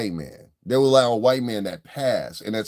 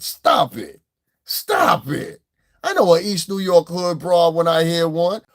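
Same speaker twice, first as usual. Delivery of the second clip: usual speaking voice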